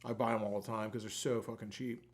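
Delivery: usual speaking voice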